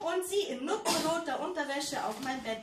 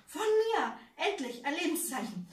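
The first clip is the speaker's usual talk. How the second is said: excited; 5 semitones higher, 5 dB louder than usual. A narrator's reading. Narrator's sudden excitement.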